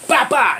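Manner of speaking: loud, high "bah, bah" of two punches; quick punch noises. Retold or imitated. Teller acting out his boxing reflex, quick punch noises. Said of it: "you know, real quick, just".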